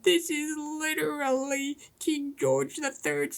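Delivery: high, whiny crybaby voice, slowing on tyranny; high-pitched sniveling baby talk, mock. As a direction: whine it like a spoiled child mocking a tantrum. Mocking crybaby racists.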